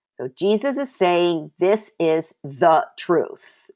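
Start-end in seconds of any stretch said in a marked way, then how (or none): 1.6-3.7 slow, weighty stress on "the" before "truth"; "the" held long and set apart. Real, not acted. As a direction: draw out "the" to mean the one and only. Preacher insists on the one truth.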